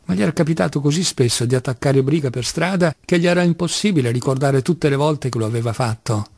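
ordinary delivery

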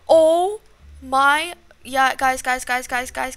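loud, urgent calling out; panicked. Real, not acted. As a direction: call out in alarm, repeating urgently. Cornered by the killer.

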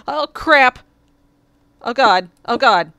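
loud panicked oh crap; loud startled exclamations; startled shout. Real, not acted. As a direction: yelp in sudden alarm. Sudden startle at end.

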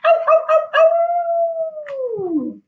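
high yipping howls fading off; pack of wolves howling. A narrator's reading. Narrator voicing the whole pack joining in, howling. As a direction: howl along with the pack. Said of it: "throw back their heads and join in".

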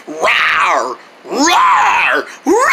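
low growling toy dinosaur rawr; dinosaur roar. Pretend. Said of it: "stuffed animal dinosaur".

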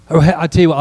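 ordinary talk, nothing marked

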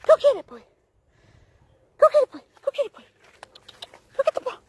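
very high, loud eager urging; excited dog call. Real, not acted. Owner urging Zeus to chase the ball, high excited calls. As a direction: hype the dog up to run.

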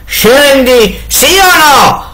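enraged husband yells at his wife; furious yell. A narrator's reading. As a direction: spit out the last ultimatum. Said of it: "urlò inviperito Barbablù".

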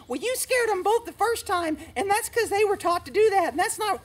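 high nagging mock of a know-it-all viewer; mocking a critic. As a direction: parrot the critic snidely. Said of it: "somebody's gonna go".

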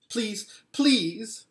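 eager pleading. A narrator's reading. Edmund begging the Queen for sweets, eager pleading. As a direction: blurt it out, pleading. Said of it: "said Edmund suddenly".

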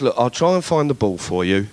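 calm him down like a patient guard; low placating guard. Retold or imitated. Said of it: "he goes".

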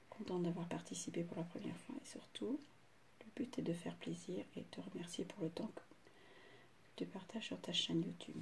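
reads the letter under her breath, barely voiced; whispered letter reading. Real, not acted. Whispering the friend's letter while unboxing. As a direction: murmur the kind words to herself.

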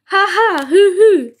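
high owlish "ha ha, hoo hoo"; hooting laughter. A narrator's reading. Wide Eye amused by the prank, hooting laughter. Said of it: "laughed and laughed".

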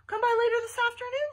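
high, loud, sweetened invitation; forced friendliness. A narrator's reading. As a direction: make yourself sound friendly to a small kid. Forcing friendliness for small kid.

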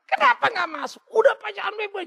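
high-pitched mock wife voice; squeaky fussing wife, hammed-up; wife voiced high. Wife fussing at the pastor while he drives, high mock voice.

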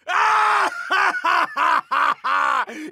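roaring evil cackle; maniacal cackle. Acted. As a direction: cackle like a mad scientist. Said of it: "He cackled maniacally".

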